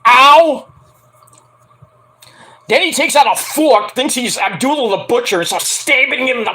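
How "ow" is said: loud sympathetic yelp; mock pained ow. Real, not acted. Fan wincing at the chokeslam with a loud ow. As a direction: wince out loud for him.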